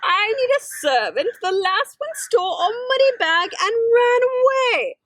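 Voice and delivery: high, wailing giantess voice; crying giantess. Acted. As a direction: wail it out like a desperate giantess. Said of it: "she grabbed Kate and cried".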